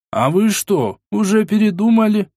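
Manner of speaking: high-pitched puzzled question; surprised old man. A narrator's reading. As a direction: ask in surprise, pitch climbing. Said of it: "удивился он".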